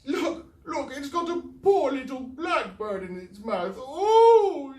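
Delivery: high, loud crying wail. Acted. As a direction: sob it out over the bird. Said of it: "she wailed even louder".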